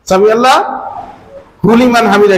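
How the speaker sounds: slow, every syllable of Liman laid out clearly; slow careful recitation. Shown as a demonstration. Preacher showing worshippers the correct words, slow and careful. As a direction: recite it slowly so the congregation hears each sound. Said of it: "খেয়াল করবেন ভালো করে".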